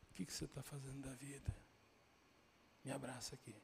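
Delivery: hushed, soft question and request; tender reproach. Retold or imitated. Mother turning to her son after shouting at crowd, hushed tenderness. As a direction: drop right down to speak only to your child.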